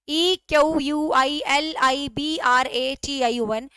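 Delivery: slow letter-by-letter spelling. Shown as a demonstration. Teacher slowly spells the word for the class, letter by letter. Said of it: "spelling बोल देती हूँ".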